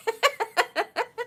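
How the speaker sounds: quiet high laughter; a light high laugh fading; soft laugh. Real, not acted. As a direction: let a soft laugh slip out. Laughs after teasing viewer.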